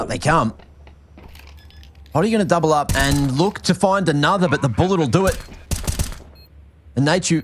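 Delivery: live esports casting; play-by-play commentary. Acted. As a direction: call the round as it unfolds.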